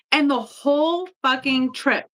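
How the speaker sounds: ordinary talk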